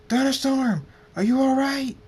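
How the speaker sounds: high, loud, alarmed scream. A narrator's reading. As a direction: scream out to a friend in danger. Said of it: "Draconic screams".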